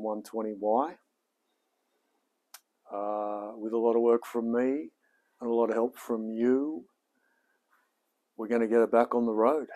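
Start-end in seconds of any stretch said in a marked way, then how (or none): none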